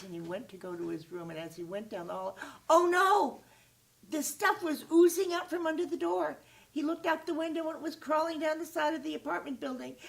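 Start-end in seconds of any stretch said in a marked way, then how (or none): 2.7-10.0 high, loud, breathless storytelling; alarmed narration. A narrator's reading. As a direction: tell it like the monster is escaping. The stuff oozes out.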